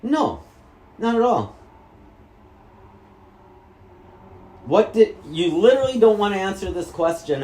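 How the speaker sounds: loud, high outburst; angry yell. Real, not acted. Son yelling at his mother in frustration. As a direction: snap back in anger.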